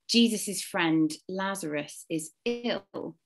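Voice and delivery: ordinary delivery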